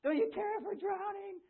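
high, loud panicked cry to a sleeping man; terrified disciples yelling over the storm; panicked shout. Retold or imitated. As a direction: shout it high like you're sinking. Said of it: "and they're like".

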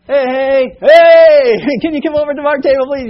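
loud, high, fast hailing with a laugh; calling out. Retold or imitated. Dad at a diner calls the grumpy waitress over, loud laughing hail. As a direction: wave down the waitress, half laughing.

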